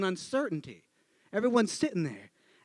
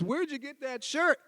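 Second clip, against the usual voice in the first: high, stagey question rising at the end; skit setup line; 7 semitones higher, 4 dB quieter than usual. Acted. First actor walking by the bench, stagey question. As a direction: chirp the corny opening line.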